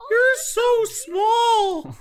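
squealing at the tiny elf; amazed shout. Real, not acted. Players meet tiny elf.

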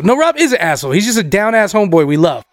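ordinary talk

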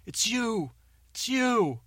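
high mock accusing yell; angry employees. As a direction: point and shout it's you. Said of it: "they're like".

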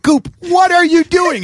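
high, loud and slow, pleading alarm; alarmed call. Retold or imitated. To Goop hunting the tire iron, loud alarmed call. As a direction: halt a drunk friend with a loud cry. Confronting drunk bandmate again.

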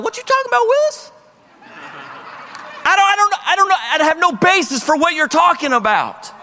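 high, hammed-up sitcom voice, baffled; bemused God. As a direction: play God as a sitcom kid who can't make sense of it. God's bemused reply, sitcom quote.

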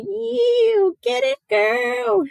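very high, slow Australian cheer; imitating Shawnee. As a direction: stretch it out like an Aussie friend hyping you up. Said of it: "such a good impression".

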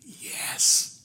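put-on slow, secretive little boy whisper; child's whisper. Little boy whispering to the salesman on the phone. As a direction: breathe it like a kid hiding something. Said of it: "And the little voice said".

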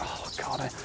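ordinary delivery

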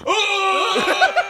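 high, loud yelp; mock pained yelp. Imitating Keanu being shocked, a loud high yelp. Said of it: "he just goes".

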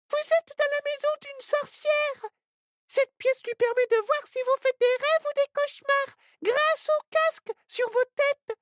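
very high cartoon animal voice; squirrel voice. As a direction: play a panicked little squirrel. Frightened squirrel speaks.

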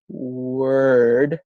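"word" with a long drawn-out opening W; stretched sound. Shown as a demonstration. Clinician demonstrating preparatory sets, W of "word" stretched. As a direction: ease slowly into the W of "word". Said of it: "and you stretch it out".

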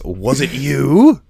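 hammed-up accusing performer. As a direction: draw it out into the mic, accusing. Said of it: "microphone gets stuck in my face".

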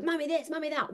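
put-on high, whiny kid voice; mock a child nagging for attention; lightly voiced whining kid; mimicking a whiny child.

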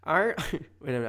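ordinary delivery

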